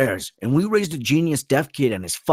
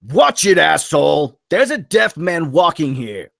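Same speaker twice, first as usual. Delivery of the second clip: loud, high furious yell at the driver; angry shout; 8 semitones higher, 10 dB louder than usual. Acted. Damien yelling at the driver who nearly ran them over, furious shout. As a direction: bellow it across the street.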